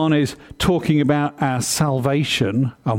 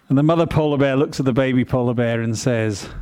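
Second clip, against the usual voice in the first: ordinary talk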